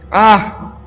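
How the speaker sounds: ordinary delivery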